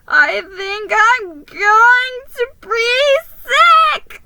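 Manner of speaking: high, loud, slow sick wail; queasy panic. Acted. Mouse wailing to the elf about being sick. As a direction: moan that you're about to be sick.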